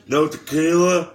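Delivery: punchy wrestler impression; Stone Cold Steve Austin. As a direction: call it out for the crowd's response. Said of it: "this is your boy, Stone Cold Steve Austin".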